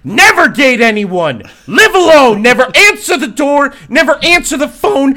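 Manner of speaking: over-the-top mock shout of advice; mock warning shout. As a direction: bellow the rules like a panicked public warning. Mock safety warning to listeners.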